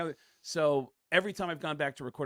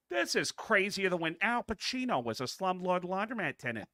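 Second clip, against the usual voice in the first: Peter Griffin; 6 semitones higher than usual. Retold or imitated. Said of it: "one line as Peter Griffin".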